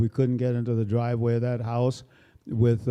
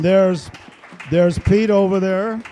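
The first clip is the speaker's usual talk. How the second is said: loud, raised announcement across the room; calling out; 7 semitones higher, 8 dB louder, slower than usual. Real, not acted. Introducing Pete to the audience, loud call-out. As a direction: call his name out to the back.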